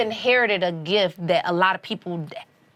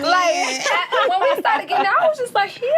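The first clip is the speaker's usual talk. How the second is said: tearful; 11 semitones higher than usual. Real, not acted. Emotional about late partner.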